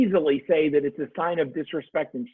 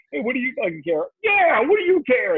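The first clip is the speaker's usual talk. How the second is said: high-pitched egging-on inner voices; little voices; 8 semitones higher than usual. Acted. Little voices stirring up a fight with his wife, high and goading. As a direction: squeak it like voices in the head, building higher. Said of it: "little voices going".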